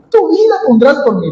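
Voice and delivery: "Tu vida pondrás por mí" high and fast, skeptical. Shown as a demonstration. Jesus repeating Pedro's boast back, fast and incredulous. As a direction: toss it back quickly, incredulous. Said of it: "Yo lo leo con este tono".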